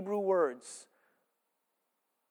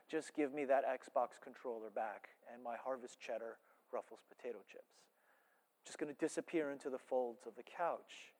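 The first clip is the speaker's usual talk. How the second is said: lazy slacker; 6 semitones lower, 13 dB quieter than usual. Retold or imitated. Lazy couch-bound Judah.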